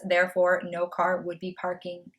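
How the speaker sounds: ordinary delivery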